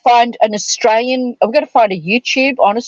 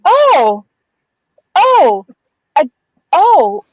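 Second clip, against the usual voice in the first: flustered oh; 5 semitones higher, 3 dB louder, much slower than usual. Retold or imitated. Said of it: "I looked at her and I said".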